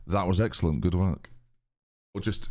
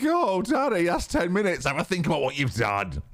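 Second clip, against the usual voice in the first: mock scolding; 7 semitones higher than usual. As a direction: scold him like a naughty child, playfully. Mock scolding a timed-out chatter.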